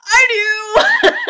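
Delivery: high, loud fake sobbing reply; mock wail. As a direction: answer in a hammed-up wail. Mother answering, mock crying.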